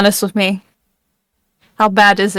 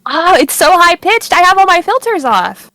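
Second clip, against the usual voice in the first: raised, higher exclamation; exclaiming; 7 semitones higher, 4 dB louder than usual. Real, not acted. Explaining to co-host about the filters, high-pitched exclaiming. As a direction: blurt it out high, in protest.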